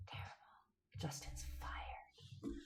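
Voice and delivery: hushed mock-dramatic whisper; breathless stage whisper of bad news. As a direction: whisper it like a scandalous secret. Joking aside to the chat.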